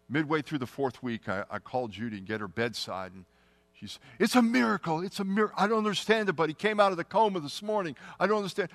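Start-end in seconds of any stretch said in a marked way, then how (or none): 4.2-8.7 much higher, breathless with wonder; much higher astonished joy; overjoyed disbelief. Retold or imitated. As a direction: gush like you can't believe the news. Wife reports husband woke from coma.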